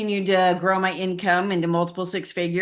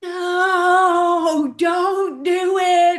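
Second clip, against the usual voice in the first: high, sung-out, drawn-out warning; gut voice warning; 12 semitones higher, 5 dB louder, much slower than usual. Retold or imitated. Her gut warning her against the decision, high and drawn out. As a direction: sing the gut's warning slowly and high. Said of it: "and then your gut's going".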